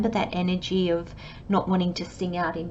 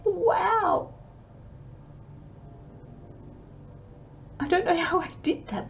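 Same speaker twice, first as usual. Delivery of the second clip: much higher, bright amazed wow; delighted wow; 10 semitones higher, 6 dB quieter than usual. Retold or imitated. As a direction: marvel at it with joy.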